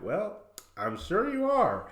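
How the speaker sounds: high, mock-sweet condescension; imitated condescending tone. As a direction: patronize them in a high, lilting voice. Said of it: "In, like, this very condescending tone".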